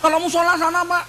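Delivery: hurried security man steering him away. Retold or imitated. Guard with walkie-talkie urgently pointing the cleric to the prayer room. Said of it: "Udah kan".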